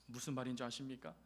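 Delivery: ordinary delivery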